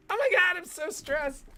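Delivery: high, panicky exclamation; high mock panic; mock stressed panic. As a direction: play up the live-stream stress. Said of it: "you're like".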